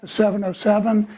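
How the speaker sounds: ordinary talk, nothing marked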